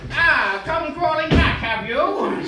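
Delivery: loud, smug taunt; gloating. Acted. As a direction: crow over him loudly. Rival gloats at begging writer.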